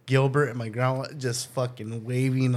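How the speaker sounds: normal talking voice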